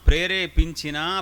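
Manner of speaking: ordinary talk, nothing marked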